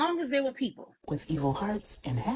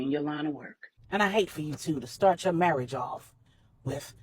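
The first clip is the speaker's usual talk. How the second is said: put-on a lightly lowered, firm older man; Manny, firm and protective; 4 semitones lower, 8 dB louder than usual. A narrator's reading. Manny warns Corey his island is safe, lightly voiced and firm. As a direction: lay down the law on his own turf, steady.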